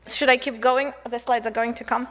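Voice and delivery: usual speaking voice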